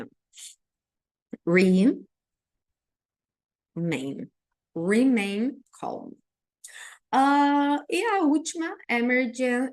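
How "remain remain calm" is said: "Remain" stressed, then slow "Remain calm"; model pronunciation. Shown as a demonstration. Teacher drilling the phrase for the class: slow and clear. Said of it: "a pronúncia do remain fica assim".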